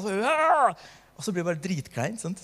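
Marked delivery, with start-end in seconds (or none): 0.3-0.9 high, loud forced yell; a put-on scream in prayer; mock scream. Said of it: "og skrike da". (påtatt, høyt skrik)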